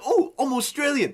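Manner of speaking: loud, high mock Australian; badly faked Australian accent. Mocking assistant accent options for friends, bad Australian accent. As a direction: attempt an Australian accent and overdo it. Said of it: "That's not an Australian accent".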